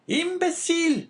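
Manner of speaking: very high, loud scornful cry; exclaiming wife. A narrator's reading. Wife insulting her husband, high exclamation. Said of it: "exclaimed La Carconte".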